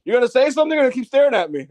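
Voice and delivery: high, fast mock wrestler challenge; lightly voiced Sammy taunting. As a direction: snap it back in a hammed-up voice. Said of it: "Sammy literally goes".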